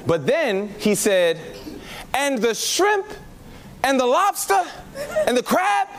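usual speaking voice

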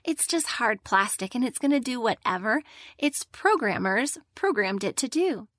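ordinary talk

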